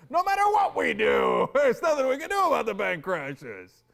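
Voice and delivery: high, loud mocking shout of the quote; hammed-up outraged quote. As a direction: yell his words back at him, mocking. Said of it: "You literally said".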